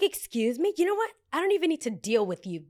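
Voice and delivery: high, indignant brush-off; mock indignation. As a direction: act out an offended diva's dismissal. Acting out the woman's offended brush-off.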